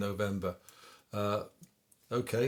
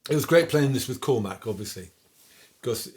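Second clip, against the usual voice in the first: normal talking voice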